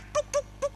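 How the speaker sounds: high clucking tuk sounds; peck-peck call of a tricky rooster. Retold or imitated. Preacher mimics a rooster faking food to tempt the hen. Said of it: "patok-patok seolah-olah ada makanan".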